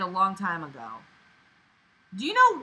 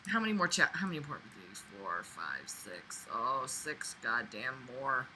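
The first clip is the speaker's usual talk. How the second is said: low and much softer, trailing into a groan; dismayed mutter; 10 semitones lower, 14 dB quieter than usual. Real, not acted. Reader dreading more pages, muttering softly in dismay. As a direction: mutter the count under your breath in dread.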